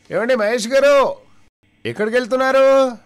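a deaf villager hollering a question to a deaf friend; deaf man shouting. Retold or imitated. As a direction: shout as if the other can't hear. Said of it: "ఇద్దరు చెవిటి వాళ్ళే".

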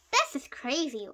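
usual speaking voice

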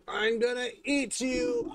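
mock monster threat. Playful threat to cat.

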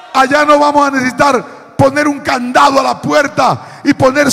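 forceful, ringing sermon delivery; predicación a plena voz. Real, not acted. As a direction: preach it out, pushing each phrase to a strong close. (predicando con fuerza)

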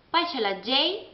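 usual speaking voice

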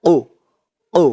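"qu" with a little push from inside the throat; throat-pushed qaf. Shown as a demonstration. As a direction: knock the q out from the throat on ও. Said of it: "গলার ভিতর থেকে একটু ধাক্কা খেয়ে আসবে".

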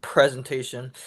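real stutter, repeating the p; stuck on the first sound. Real, not acted. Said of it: "I have a stutter".

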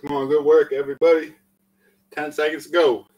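loud, high pep talk to the class; excited encouragement. Real, not acted. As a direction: cheer everyone through the final seconds. Coach cheering the class on.

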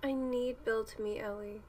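ordinary delivery

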